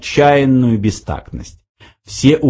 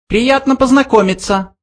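high squeaky mouse; polite tiny voice; 13 semitones higher than usual. A narrator's reading. Second mouse greets Arthur, high squeak. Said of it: "сказал другой мышь".

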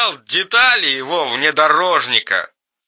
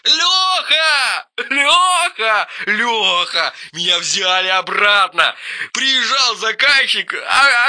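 overjoyed; 9 semitones higher, 4 dB quieter than usual. Acted. Friend shouts joyful news.

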